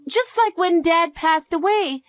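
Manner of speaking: put-on high childlike voice, anxious for Mom; little girl fearing for her mother; worried young girl. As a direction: ask like a kid seeking reassurance.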